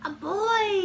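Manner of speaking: high, loud startled holler; storybook yell. A narrator's reading. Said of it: "yelled the bear".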